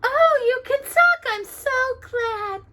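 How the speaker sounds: high, slow delighted voice; high slow happy princess voice; glad princess. A narrator's reading. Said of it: "She just continued gladly".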